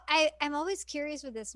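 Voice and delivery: normal talking voice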